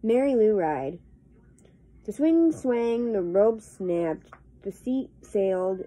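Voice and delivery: slow, measured verse with drawn-out beats; poem reading. Acted. Vlogger reading a swing poem to viewers, slow rhythmic recitation. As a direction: stretch each short phrase to the rhythm.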